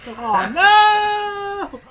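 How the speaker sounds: high loud oh no; dismayed shout. Real, not acted. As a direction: cry out at sudden defeat. Losing in game.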